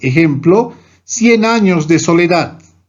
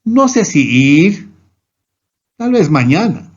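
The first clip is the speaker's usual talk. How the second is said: hesitant. Shown as a demonstration. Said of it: "duda o vacilación".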